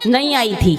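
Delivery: normal talking voice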